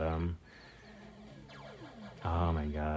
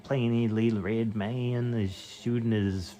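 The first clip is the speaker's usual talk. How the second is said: put-on lightly higher New Jersey accent narrating gameplay; 5 semitones higher than usual. Streamer narrating his game in a mock New Jersey accent. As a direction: keep the mock Jersey voice going. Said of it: "Can you try to do a New Jersey accent?".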